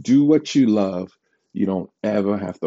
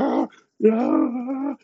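much higher, strained little cries; dramatic whimpers. Retold or imitated. He whimpers at the doctor holding the swab. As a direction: squeak out two panicked ahs. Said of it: "I'm dramatic when things happen".

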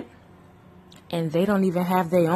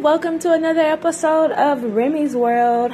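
upbeat intro; 9 semitones higher than usual. Real, not acted. Vlog intro.

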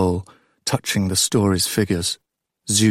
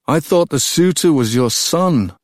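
higher, louder, amused interruption; a bemused stranger calling out from the bench; wry newcomer's voice; 7 semitones higher, 5 dB louder than usual. A narrator's reading. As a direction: needle the proud king with a smirk. New voice interrupts the king.

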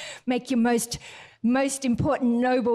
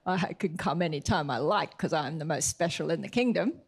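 smug Haman; 6 semitones lower than usual. Retold or imitated. Haman answers the king, low and smug. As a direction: play the arrogant noble.

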